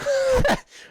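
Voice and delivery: loud, high-pitched laugh; burst of laughter. Real, not acted. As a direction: crack up mid-story. Cracks up at his own story.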